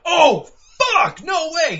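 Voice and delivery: high, loud shocked cry; shocked outburst. Retold or imitated. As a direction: blurt it in total disbelief. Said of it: "It's not like".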